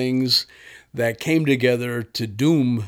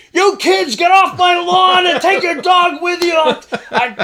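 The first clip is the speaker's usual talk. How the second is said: put-on high, loud cranky old man yelling; sputtering old-timer shouting at kids; angry old man; 7 dB louder than usual. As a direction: chase the kids off your lawn, shouting. Prank caller voices angry old man.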